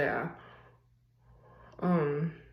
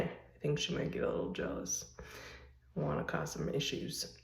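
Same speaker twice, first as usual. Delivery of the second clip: whispered aside; 8 dB quieter than usual. Acted. Teasing aside about her mother.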